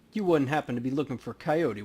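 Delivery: normal talking voice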